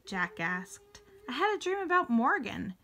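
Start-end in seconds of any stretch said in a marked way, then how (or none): 1.3-2.7 eager little sister, pitched high; high-pitched, bursting with news; excited exclamation. A narrator's reading. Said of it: "exclaimed Annie".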